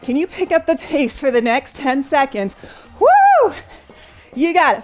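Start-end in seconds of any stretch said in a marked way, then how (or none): 0.0-2.5 upbeat workout coaching; aerobics instructor. Acted. Leads workout interval live. 3.0-3.5 high loud cheering whoop; excited woo. Real, not acted. Cheering end of interval.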